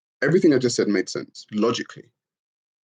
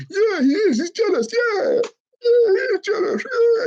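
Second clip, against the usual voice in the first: much higher, squeaky, eager agreeing voice; mock cheering sycophants. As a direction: play giddy fans high-fiving each other. Mocking commenters cheering along.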